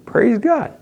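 ordinary talk

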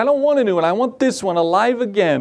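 put-on high-pitched child's protest; upset little girl. Little girl rejecting a new hamster, upset and high-pitched. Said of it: "she was just so upset".

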